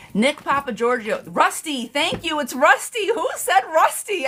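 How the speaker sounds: high, thrilled cries of recognition; excited. Real, not acted. Tarot reader thrilled a viewer named the movie. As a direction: squeal with delight someone got it.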